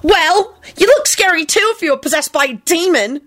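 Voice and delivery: high-pitched mean retort; unkind. A narrator's reading. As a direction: snipe back in a high voice. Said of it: "Dorcas responded unkindly".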